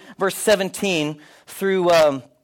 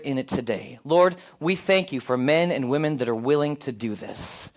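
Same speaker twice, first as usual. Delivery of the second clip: prayer; 4 semitones lower than usual. Acted. Pastor leads opening prayer.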